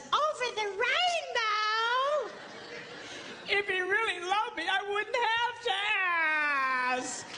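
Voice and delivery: high, slow, sing-song mock longing; mock dreamy wife. Preacher mocks a wife pining to be asked. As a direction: ham up the wistful wife.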